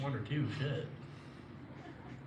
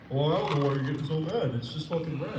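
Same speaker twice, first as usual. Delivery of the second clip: Alan voice; 4 semitones higher, 5 dB louder than usual. Retold or imitated. Alan voiced in retold argument.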